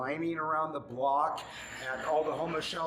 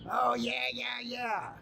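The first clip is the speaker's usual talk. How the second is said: mocking quote; 6 semitones higher, slower than usual. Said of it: "mocking dismissive yeah".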